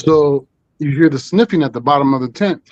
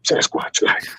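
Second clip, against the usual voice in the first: whispered; 5 dB quieter, slower than usual. Acted. Hushing the word Sasquatch.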